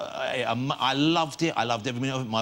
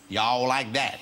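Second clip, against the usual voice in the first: mock Southern drawl; 2 semitones lower, much slower than usual. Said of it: "a little bit Southerny".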